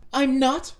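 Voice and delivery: high, loud protest; indignant denial. Acted. Arguing about starving oneself, indignant denial. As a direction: cry out in denial.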